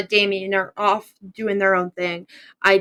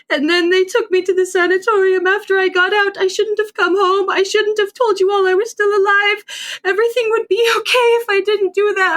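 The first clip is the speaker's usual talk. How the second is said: high, tearful apology breaking through sobs; openly weeping; 9 semitones higher, 3 dB louder than usual. Acted. Chatwin to his grieving family, weeping apology. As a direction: pour it out in tears, voice pitched up. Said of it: "Still just openly weeping".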